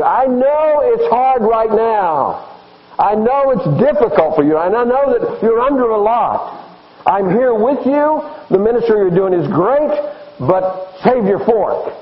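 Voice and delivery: high, earnest preacher voicing God; God speaking encouragement. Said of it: "And God is saying to you".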